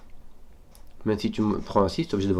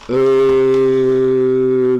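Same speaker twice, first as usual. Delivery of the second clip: drawn-out loud ehh, held; long loud groan; 4 semitones higher, 13 dB louder than usual. Real, not acted. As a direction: hold a long, loud pondering ehhh. Long held sound while thinking.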